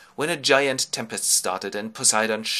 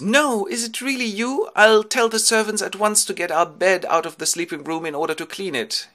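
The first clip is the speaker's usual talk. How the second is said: high, loud, fluting woman's voice; exclaiming wife; 10 semitones higher, 6 dB louder than usual. Acted. Penelope greeting returned husband, high excited gushing. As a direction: gush in a sustained high voice. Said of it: "And she goes".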